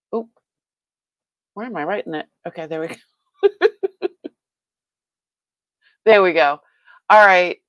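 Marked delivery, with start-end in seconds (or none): 3.4-4.3 laughing. Real, not acted. Streamer laughing at her own mix-up, high and loud.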